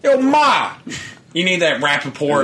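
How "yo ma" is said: loud Brooklyn holler; Brooklyn accent. Retold or imitated. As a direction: yell it like a New York guy. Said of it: "a Brooklyn accent, like, uh, Rappaport does".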